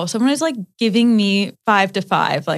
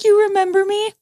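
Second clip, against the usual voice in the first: teary teacher; 13 semitones higher than usual. Retold or imitated. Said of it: "She, like, starts crying".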